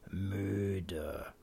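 low, soft, drowsy murmur; weary grandpa muttering; sleepy murmur. A narrator's reading. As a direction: mumble it as if nodding off. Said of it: "murmelt Opa".

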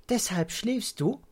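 put-on high, curious child's voice; wondering child. A narrator's reading. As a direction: ask as if it just dawned on you. Child grasps the reason.

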